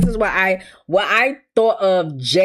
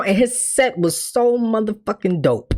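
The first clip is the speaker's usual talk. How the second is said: ordinary delivery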